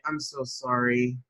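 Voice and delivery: softly retold calm, drawn-out apology; slow it down and keep it contained. Man reacting to the dent on his car, calm. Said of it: "I calmly walk to the dent".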